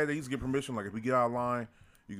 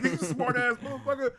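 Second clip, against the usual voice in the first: high, slow outraged mutter; mock offended grandpa; 7 semitones higher, slower than usual. Retelling Martin Lawrence's bit about the kid, slow and high. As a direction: play the man insulted by the kid.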